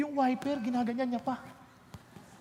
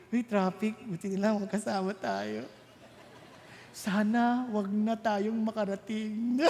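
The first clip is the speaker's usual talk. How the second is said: mock sweet lover; 6 semitones lower, slower than usual. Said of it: "kasintahan nyo pa lang".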